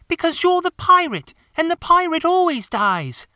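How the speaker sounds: put-on high, loud little boy insisting; a small child laying down the rules; young boy. Michael tells John the pirate always dies, high child voice.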